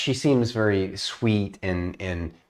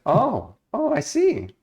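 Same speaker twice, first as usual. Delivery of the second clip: high, startled little realization; surprised; 14 semitones higher, 3 dB louder, slower than usual. Acted. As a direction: catch yourself, surprised, much higher. Elf reacts in surprise.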